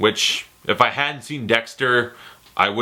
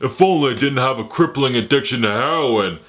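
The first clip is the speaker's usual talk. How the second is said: Stallone. Retold or imitated. Stallone explaining the custody arm wrestle, slurred impression. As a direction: plead through a thick mumbling slur. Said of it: "Like, he's like".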